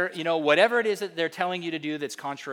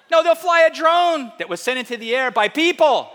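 high, loud mock insistence; hammed-up loud protest; mock objector; 9 semitones higher, 9 dB louder than usual. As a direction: argue back as a foolish objector, pitched way up. Mock argument over deliveries.

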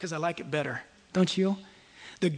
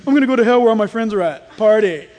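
street partier boast; 3 semitones higher, 11 dB louder, faster than usual. Retold or imitated. Mimics boast heard on streets.

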